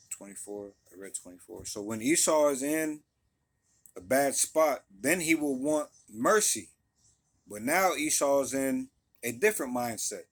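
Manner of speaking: normal talking voice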